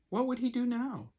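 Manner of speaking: usual speaking voice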